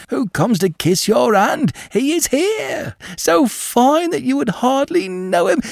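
put-on high, eager old servant announcing; excited old servant. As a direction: gush it out like a delighted old servant.

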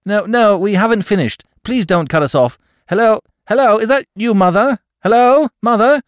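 loud, high calls down the line; shouting into phone. Acted. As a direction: shout to keep the line open.

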